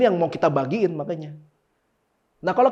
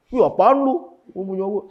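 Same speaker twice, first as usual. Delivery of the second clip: mock greedy snap; 4 semitones higher, 5 dB louder than usual. Pastor acts greedy person.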